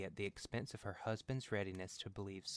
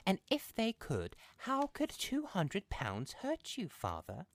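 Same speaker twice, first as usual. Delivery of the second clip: higher, scornful mother's voice; wife mocking husband's belief, higher voiced; scoffing old mother; 9 semitones higher than usual. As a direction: scoff at the nonsense like a dismissive wife. Mother character voice.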